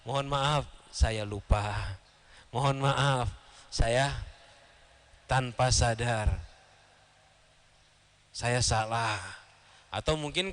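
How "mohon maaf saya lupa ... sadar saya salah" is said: low, slow acted confession; imitated sincere admission, pitched down; frank apology. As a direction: own up humbly, taking your time. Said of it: "dia bakal terus terang".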